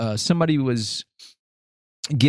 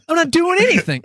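mock innocent denial; 5 dB louder than usual. Retold guilty denial.

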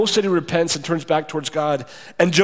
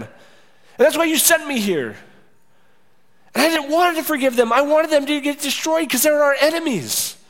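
angry Jonah; 8 semitones higher than usual. Acted. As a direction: spit out the grudge, pitched high. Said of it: "It's like".

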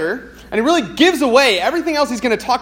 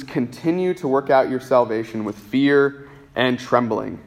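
scripture reading; 6 semitones lower than usual. Acted. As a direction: read the closing verses gently and gravely. Pastor reads Philippians chapter two.

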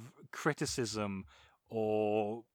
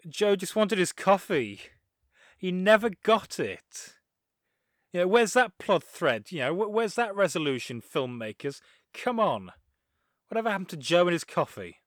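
higher, louder comic indignation; mock outrage; 5 semitones higher, 4 dB louder than usual. Film fan demanding justice for Joe's coffee, mock outrage. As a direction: rant in mock protest at the filmmakers.

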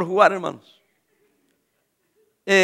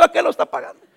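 chuckling at the end of the joke; laughing punchline; 7 semitones higher, faster than usual. Retold or imitated. Pastor teasing his host who pays, laughing. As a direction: let a laugh slip as you deliver it.

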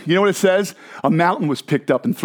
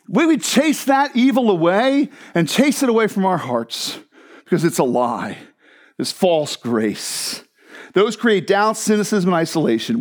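normal talking voice

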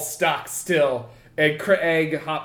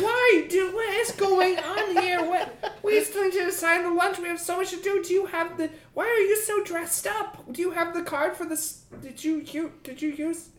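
much higher, rattled boss questions; a panicky boss firing questions; high frantic boss; 12 semitones higher than usual. Acted. Boss demanding answers from his wife, high and frantic. Craig voiced high throughout.

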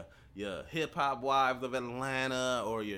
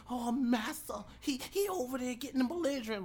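high, whiny snitching voice; mock tattling servant; 11 semitones higher than usual. Mocking coworkers who snitch to the boss, high whiny voice. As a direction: simper it like a tattletale. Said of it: "they definitely be ready to tell".

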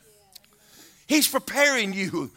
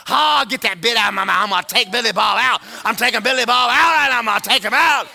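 mock fury; 4 semitones higher, 8 dB louder, faster than usual. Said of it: "you're gonna be going".

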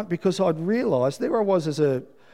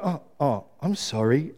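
sheepish apology; 3 semitones lower, 6 dB quieter than usual. Retold or imitated. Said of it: "very sheepishly".

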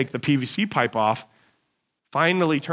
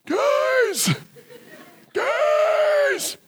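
very high, thin, drawn-out cry; strangled yell for help. Retold or imitated. Trapped worker calling his crew while short of breath, strangled high yell. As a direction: call out with no air to yell. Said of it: "I couldn't yell around the house".

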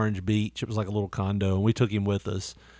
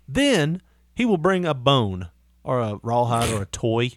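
ordinary talk, nothing marked

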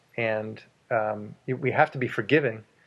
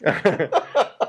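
laughing; 7 semitones higher, 6 dB louder than usual. Real, not acted. Laughing after French joke.

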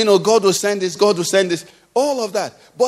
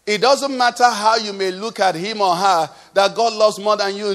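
ordinary delivery

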